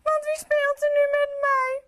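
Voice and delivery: high, loud whiny lament; lonely king crying out that nobody plays with him, high and loud; sad cry. Acted. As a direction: wail like a lonely child. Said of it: "hij roept verdrietig".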